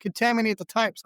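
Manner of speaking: a hint of a woman warning a customer; imitating shop woman. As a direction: raise the pitch to mimic her objection. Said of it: "She goes".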